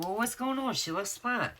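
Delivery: high-pitched puzzled protest; imitating her own puzzled reaction; retold bafflement. Retelling her puzzled reaction to dad.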